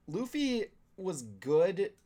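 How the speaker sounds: ordinary talk, nothing marked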